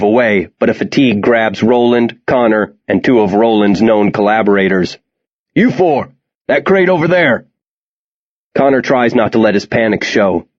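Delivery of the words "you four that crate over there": raised, higher commanding call; shouted order. Acted. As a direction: call it out across a crowd. Soldier assigning kids to crates.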